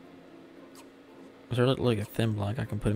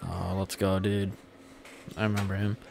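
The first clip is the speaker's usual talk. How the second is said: ordinary talk, nothing marked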